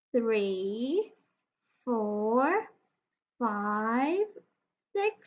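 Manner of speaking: put-on slow counting for kids; counting aloud. Shown as a demonstration. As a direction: count along with the child. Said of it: "So let's count".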